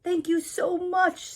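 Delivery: high, loud thankful farmer; grateful farmer voice. A narrator's reading. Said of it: "says farmer".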